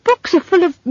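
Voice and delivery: high, urgent, sticking on the next word; urgent stammer. Acted. Simon explaining books to Esk, urgent stammer. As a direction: press the point and get stuck. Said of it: "said Simon urgently".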